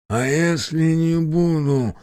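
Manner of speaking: forcing words through tape; gagged, muffled voice. A narrator's reading. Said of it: "попытался выдавить я сквозь скотч".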